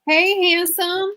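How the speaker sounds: high, slow, drawn-out coo; cooing at the cat. Real, not acted. Greeting the handsome cat, slow high coo. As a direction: stretch it out sweetly for the pet.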